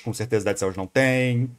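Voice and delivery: usual speaking voice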